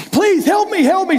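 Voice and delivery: high, loud terrified plea for help; mock screaming. Preacher's dying deacon begging for help, screamed high. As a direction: shriek it like a dying man begging.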